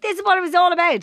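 loud, high, quick proud outburst; mock triumph. Host voicing a message to parents with a photo, mock triumph. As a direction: exclaim it as a proud announcement.

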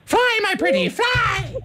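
high cackling witch shriek. Pretend. Host playing Wicked Witch.

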